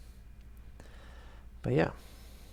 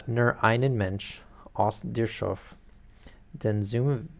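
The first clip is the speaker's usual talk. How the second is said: self-mocking German read in a French accent, slow; terrible German; slower than usual. Shown as a demonstration. Reader warns chat before she reads Goethe in bad German with French accent. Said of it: "mixing in my French accent".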